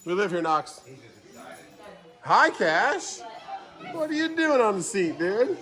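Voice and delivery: high, sing-song pet talk. Real, not acted. Talking to dogs and babies.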